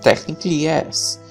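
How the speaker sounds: ordinary talk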